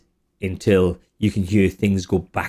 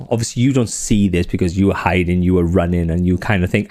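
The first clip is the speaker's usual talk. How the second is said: ordinary delivery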